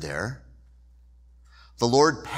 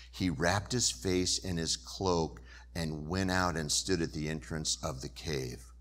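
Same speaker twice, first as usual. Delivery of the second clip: ordinary talk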